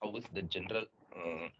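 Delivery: very faint, far-off voice; speaking much too softly; barely audible. Real, not acted. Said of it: "Your voice is very low".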